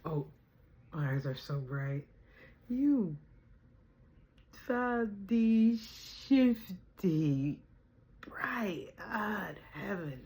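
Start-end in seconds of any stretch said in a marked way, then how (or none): 2.7-10.2 very slow, fading to a whisper; slow dreamy drawl. Pretend. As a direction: stretch it out like a trance. Dreamy drawn-out then whispered.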